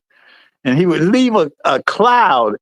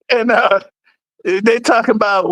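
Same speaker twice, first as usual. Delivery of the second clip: ordinary delivery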